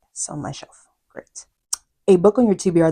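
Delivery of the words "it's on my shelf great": whispered aside. Real, not acted. Confiding aside.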